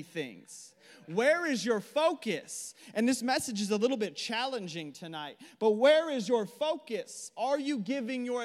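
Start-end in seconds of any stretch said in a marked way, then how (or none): 1.1-2.7 slow, high and loud, hitting where; emphatic challenge. Real, not acted. Preacher challenging the congregation, slow and forceful. 5.7-7.3 slow, high and loud, hitting where; emphatic challenge. Real, not acted. Preacher challenging the congregation, slow and forceful.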